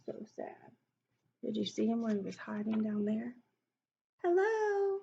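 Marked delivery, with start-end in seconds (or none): 0.1-0.7 hushed and sorry; whispered sadness. Real, not acted. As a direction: whisper it sadly. Pity aside to child.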